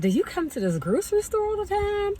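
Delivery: high-pitched, quick imitation of an old lady; sweet old lady. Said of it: "little sweet old lady".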